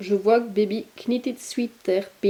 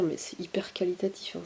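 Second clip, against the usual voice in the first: whisper; 2 semitones lower, 9 dB quieter than usual. Real, not acted. Confiding about the quality.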